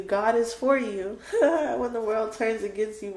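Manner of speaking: moved, laughing through tears; tearful laugh. Real, not acted. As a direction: let a teary laugh break into the reassurance. Moved to tears while encouraging viewer.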